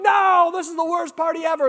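high, loud party guest complaint; mock outrage. Guests complaining the host ran out of wine, mock outrage. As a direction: cry out in mock disgust.

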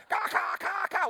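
very high, squawked caws; kids' monkey cawing. Retold or imitated. Coach mimicking first graders, high monkey squawks. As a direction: imitate screaming kids doing bad monkey noises. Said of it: "what they think a monkey sounds like".

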